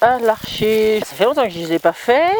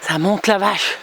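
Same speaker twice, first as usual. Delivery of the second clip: panting on the climb; breathless from the uphill; out of breath; slower than usual. Real, not acted. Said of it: "je peux plus parler".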